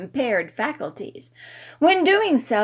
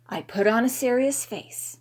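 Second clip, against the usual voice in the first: usual speaking voice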